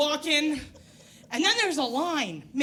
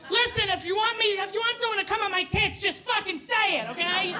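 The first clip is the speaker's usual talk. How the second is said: mock outraged rant; 7 semitones higher than usual. Telling the clinic off, high mock fury. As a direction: blow up at the absurd request. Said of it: "And I was like".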